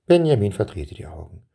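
ordinary delivery, nothing marked

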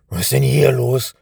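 loud, cross scolding. A narrator's reading. Thief finding the open door, scolding loudly. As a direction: scold him angrily. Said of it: "schimpfte der eine".

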